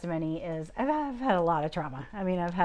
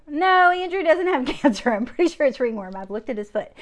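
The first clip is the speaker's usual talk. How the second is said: high, loud mock denial; retold protest; 7 semitones higher, 8 dB louder than usual. Mother disputing the doctor's diagnosis, protesting. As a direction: argue back in a raised, half-amused voice.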